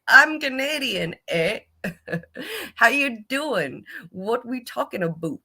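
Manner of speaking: playful put-on Canadian accent, slow and high with a laugh; lightly faked Canadian "eh" voice; mock Canadian accent. Pretending to be Canadian abroad, mock Canadian accent. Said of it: "I'm like".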